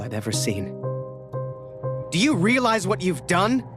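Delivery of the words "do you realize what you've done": high-pitched angry demand, understated roar; roared rebuke. A narrator's reading. Major confronting the soldier over his actions, roaring. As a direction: roar it like an officer inches from a face. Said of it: "he roared, inches from my face".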